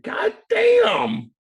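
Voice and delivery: hammed-up shocked yelp; startled. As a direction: jump at the insult. Turning round, startled by chirps.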